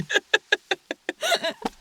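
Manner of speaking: real laugh breaking out, high-pitched; high burst of laughter. Real, not acted. Improv partner cracks up after the scene, laughing high. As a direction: laugh as the bit falls apart.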